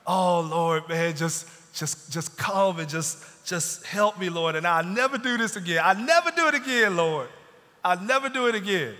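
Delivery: mock desperate prayer cranking up to a wail; desperate plea. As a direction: cry out to God like a man in trouble. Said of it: "made those prayers to God".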